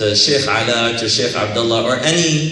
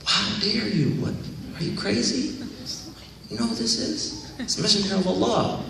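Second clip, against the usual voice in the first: hushed, scandalized rebuke pitched higher; mock outrage; 6 semitones higher, 8 dB quieter than usual. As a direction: scold him in a shocked half-whisper. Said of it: "they get mad".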